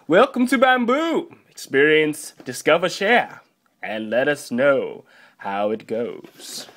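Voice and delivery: hammed-up brand announcer; put-on presenter voice. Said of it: "I like this voice now".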